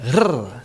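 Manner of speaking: rrr rolled on the tongue tip; "rrr" said as a rolled, trilled r. Shown as a demonstration. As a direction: roll the "rrr" as a sustained trill. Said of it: "usually trilled or rolled like".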